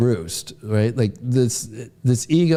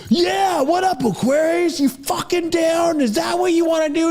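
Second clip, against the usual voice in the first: much higher, shouted rowdy greeting; rowdy friends; 14 semitones higher than usual. Retold or imitated. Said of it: "they've come over and been like".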